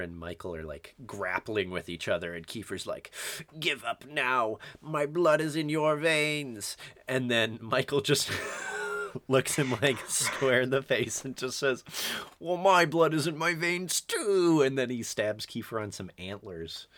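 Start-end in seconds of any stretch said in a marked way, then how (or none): 3.6-6.8 slow, mock-dramatic vampire taunt; slow melodramatic movie villain; hammy villain line. Acting Kiefer's villain line. 12.4-14.6 higher, louder hammed-up retort; mock-heroic comeback. Acting Michael's comeback line.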